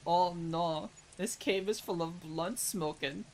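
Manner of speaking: slow, put-on German boy; lightly voiced German accent, drawn out; mock German accent. Little German boy inside the cave, mock German accent.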